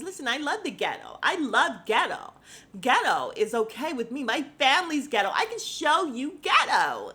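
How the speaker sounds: a mock-loud reality star doubling down; loud mock defence. Courtney's reply acted out.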